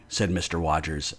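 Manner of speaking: usual speaking voice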